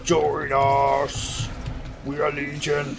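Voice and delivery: booming cult voice. Acted. Cheese cult to the hero, slow booming command. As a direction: drag each word like a god speaking.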